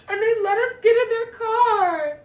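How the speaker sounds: high, loud laughing delivery; squealing laugh. Real, not acted. Telling viewers strangers helped, high laughing squeal.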